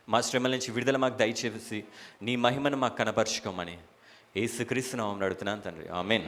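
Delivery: low, soft prayer voice. Real, not acted. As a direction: pray quietly and humbly to the Father, pitched low. Leads opening prayer. (తగ్గు స్వరంలో మెల్లగా ప్రార్థన)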